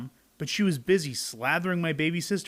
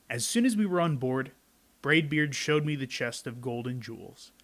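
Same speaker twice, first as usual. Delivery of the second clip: plain reading voice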